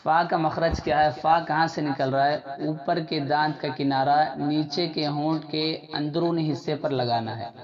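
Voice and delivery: ordinary talk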